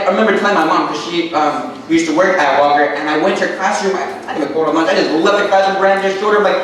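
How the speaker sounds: normal talking voice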